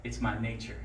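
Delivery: low, soft scorpion reply; understated punchline. Acted. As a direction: drop it low and matter-of-fact. Scorpion's deadpan punchline answer.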